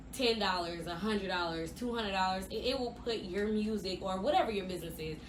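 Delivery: ordinary talk, nothing marked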